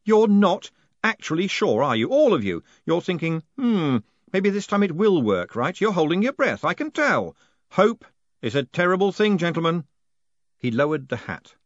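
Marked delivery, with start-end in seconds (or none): 0.0-9.8 raised, pointed ranting; heated accusation. Acted. Moist mocks the old postmen's secret hope, raised and heated. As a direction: needle them for their hope.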